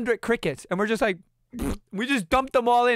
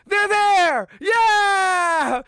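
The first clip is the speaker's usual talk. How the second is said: much higher, louder cheer of relief; overjoyed shout; 10 semitones higher, 6 dB louder, much slower than usual. Retold or imitated. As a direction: yell it like the pets made it. Geckos survived the night, overjoyed.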